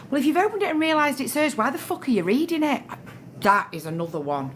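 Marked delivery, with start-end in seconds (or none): 0.1-2.8 high, rushed indignant challenge; exasperated retort. Retold or imitated. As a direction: fire off an incredulous telling-off. Acts exasperated retort about opened mail.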